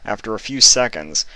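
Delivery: steady storytelling read; story narration. A narrator's reading. Reader telling the story to viewers. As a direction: read the chapter like an audiobook.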